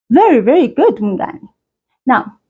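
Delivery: usual speaking voice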